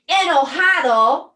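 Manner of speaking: loud, high, angry "Enojado"; angry shout. Shown as a demonstration. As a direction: bark it out furious. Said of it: "Also not a fun sentimiento". (enojado, gritado con rabia)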